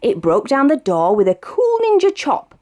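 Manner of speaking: usual speaking voice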